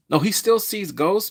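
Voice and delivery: ordinary talk, nothing marked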